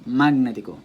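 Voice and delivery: Magnético with the first syllable "Mag" punched, the rest tumbling after; Magnético stressed on its first syllable; deliberately misplaced stress. Shown as a demonstration. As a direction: wrongly hit "Mag" in Magnético. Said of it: "try to pronounce the words incorrectly".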